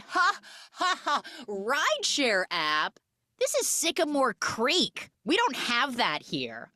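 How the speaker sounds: high, hammed-up scornful ha-ha; mocking laughter. Townsfolk laughing at city girl's rideshare, mocking. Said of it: "all laugh".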